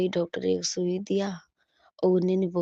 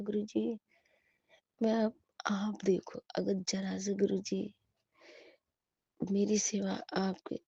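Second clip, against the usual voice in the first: a hushed, whispered prayer to guru ji; softly whispered prayer; whispered plea; 6 dB quieter than usual. Retold or imitated. Pleading to guru in retelling.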